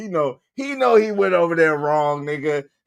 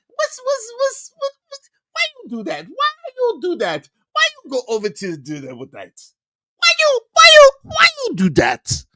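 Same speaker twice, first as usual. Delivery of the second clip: incredulous squeal; 3 dB louder than usual. Real, not acted. Incredulous ranting at Jay Prince.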